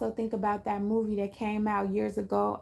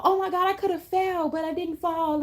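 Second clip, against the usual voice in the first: high, louder mock astonishment; amazed gush; 8 semitones higher, 5 dB louder than usual. Stranger saved from a fall, high mock astonishment. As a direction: play it wide-eyed and high. Imitating amazed helped person.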